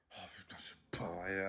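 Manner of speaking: whispered, low exasperation; muttered despair. Acted. Parent alone facing the mess, whispering in despair. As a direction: curse under your breath, worn out.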